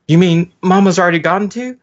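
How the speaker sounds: loud high startled question; alarmed high question. Acted. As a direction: ask it in sudden alarm.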